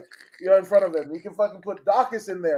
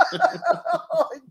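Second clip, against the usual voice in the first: laughing; 10 semitones higher than usual. Real, not acted. Laughing at his own rant.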